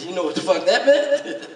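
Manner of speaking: normal talking voice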